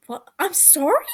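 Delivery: high, loud disbelieving question; can't believe what he just heard; incredulous. Acted. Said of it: "Phil asks incredulously".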